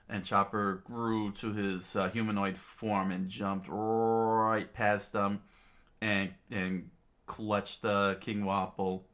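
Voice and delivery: usual speaking voice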